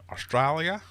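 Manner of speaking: "Australia" said Aussie style, high and loud; Australian accent. Pretend. Quizmaster reading the answer options, hammed-up Australian accent. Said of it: "Definitely wasn't trying to do an Australian accent".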